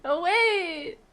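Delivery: high loud sudden exclamation; excited realization. Real, not acted. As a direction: caught by a thought. Sudden realization mid-chat.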